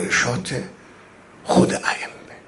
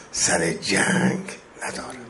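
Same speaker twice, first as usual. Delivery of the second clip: whispered lament; 4 semitones lower, 8 dB quieter than usual. Acted. Lament trailing off.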